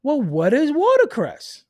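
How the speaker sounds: high, slow, puzzled mock voice; clueless average person. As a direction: play a baffled everyman asking, drawn out. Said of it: "The average person's gonna say".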